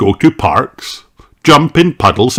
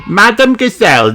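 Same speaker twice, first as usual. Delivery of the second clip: calling out; 13 semitones higher, 4 dB louder than usual. Acted. Calling the teacher at playgroup.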